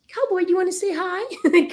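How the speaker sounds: high sing-song voice for the dog; pet talk. Real, not acted. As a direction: coo at the little dog. Talking to her dog Cowboy.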